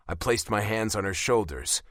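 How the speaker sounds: usual speaking voice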